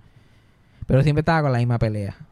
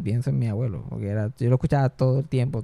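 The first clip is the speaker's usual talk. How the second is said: normal talking voice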